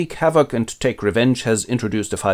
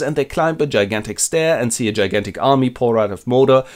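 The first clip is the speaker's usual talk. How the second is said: ordinary talk, nothing marked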